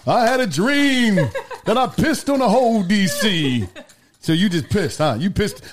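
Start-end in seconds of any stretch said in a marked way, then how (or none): 0.1-3.7 slow, high, mock-grand preacher cadence; mock dream speech. Friend mocking the bed-wetter as a dreaming orator. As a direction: ham it up like a grand oration.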